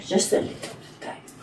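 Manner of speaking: whispered mock French accent. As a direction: murmur it like a Frenchwoman. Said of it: "See, I'm French there".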